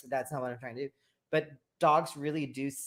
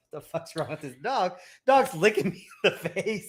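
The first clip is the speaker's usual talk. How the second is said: put-on quoting his girl, pitched up and louder on the end; playful impression of her disgust; mock girlfriend voice; 4 semitones higher, 7 dB louder than usual. Friend imitates the girlfriend's reaction to the dog, laughing. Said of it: "she was like".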